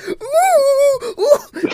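very high excited squeals; mock girlish squeal. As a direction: squeal like a little girl off to the mall. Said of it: "you sound like a little girl getting excited".

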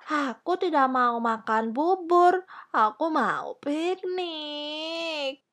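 put-on high child's whine, slowing into a wail; whining. A narrator's reading. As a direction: whinge tearfully like a sulky princess. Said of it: "rengek Putri Kecil".

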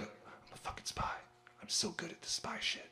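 hushed, whispered spy bragging; whispered secret boast. Acted. Cocky spy confiding in herself about her skills, whispered. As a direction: whisper it conspiratorially.

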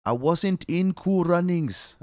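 high, slow mock Jamaican voice; exaggerated Jamaican impression; hammed-up Jamaican accent. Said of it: "I don't know how to do a Jamaican voice. I wanna try".

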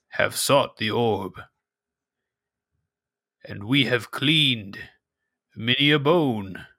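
slow, measured cave elder. Acted. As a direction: weigh each word like an old sage. GM voices Tunach.

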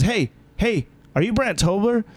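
lightly voiced young woman calling out; imitating girl. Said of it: "She goes".

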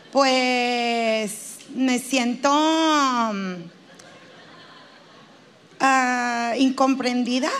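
drawn-out, hesitant confession; halting therapy patient, words held long; reluctant patient. Acted. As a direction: stretch each filler while searching for the feeling.

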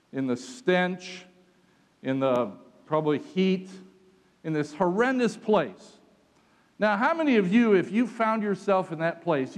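fervent sermon telling; preaching. Acted. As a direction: drive the story home to the congregation. Preaching the Paul and Silas story.

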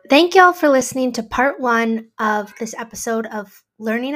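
host closing out part one of the episode; podcast outro. Acted. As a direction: wrap up the episode for the listeners.

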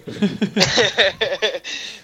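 high burst of laughter; laughing. Real, not acted. Joke about grunting.

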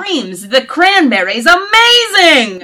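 high, loud, drawn-out rave; gushing excitement. Retold or imitated. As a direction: gush over it like a superfan. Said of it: "I literally wrote".

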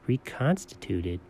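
"Reconstituted" very low and soft; hushed, slow, questioning "Reconstituted"; puzzled echo. Acted. Quietly queries the word just read.

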